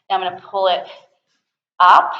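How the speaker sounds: ordinary delivery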